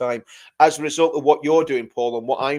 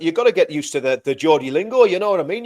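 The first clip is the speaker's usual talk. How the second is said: ordinary talk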